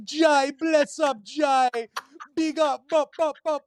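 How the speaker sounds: high-pitched mock Jamaican accent. Friend greeting a customer who joins the live, mock Jamaican accent. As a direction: imitate a hyped-up Jamaican greeting. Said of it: "starts talking in a Jamaican accent".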